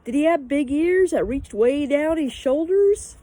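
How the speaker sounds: high, loud, slow shout. A narrator's reading. Pippi calls after the hurrying girl, shouting. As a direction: call the question out to someone hurrying away. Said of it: "but then Pippi shouted".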